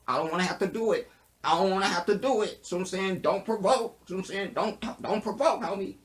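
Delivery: high-pitched, worked-up warning; mock agitated. Reviewer warning don't get me to preaching, mock agitation.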